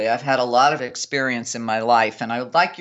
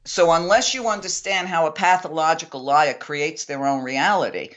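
normal talking voice

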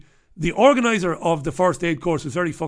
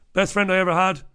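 normal talking voice

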